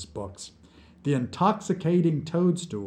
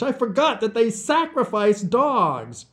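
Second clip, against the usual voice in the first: high, loud lament; raised, anguished voice; anguished cry; 10 semitones higher, 5 dB louder than usual. A narrator's reading. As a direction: wail it in sudden horrified realisation. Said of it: "Vlad cried in anguish".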